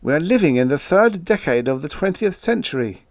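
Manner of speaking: imploring, emphatic protest; pleading. A narrator's reading. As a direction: reason urgently with a hysterical friend. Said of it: "I pleaded to quiet him".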